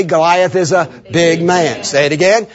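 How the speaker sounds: normal talking voice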